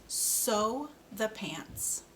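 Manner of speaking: "Sew the pants" said very slowly, each word apart; slow model sentence. Shown as a demonstration. Speech therapist models a sentence for a child, slow and careful. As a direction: draw it out slowly for the learner. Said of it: "let's try this sentence".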